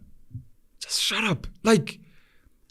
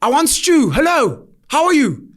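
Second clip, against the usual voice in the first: higher, louder, slow insistent whine; stubborn little sister; 7 semitones higher, 3 dB louder, slower than usual. Retold or imitated. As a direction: dig in like a stubborn kid. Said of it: "My sister's like".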